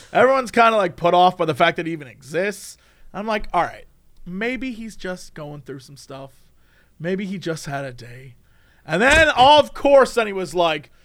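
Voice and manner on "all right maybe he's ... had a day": soft, slow self-talk; quiet forbearance. Acted. Talking oneself into patience, soft and forbearing. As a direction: murmur a patient excuse. Charitable inner thought about the man.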